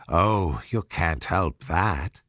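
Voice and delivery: low, sly cat. A narrator's reading.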